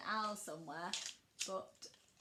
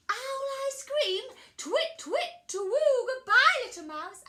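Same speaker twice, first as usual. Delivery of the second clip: high, loud hooting owl; flustered owl making a hasty exit; alarmed owl; 13 semitones higher, 14 dB louder than usual. Acted. Alarmed owl says goodbye.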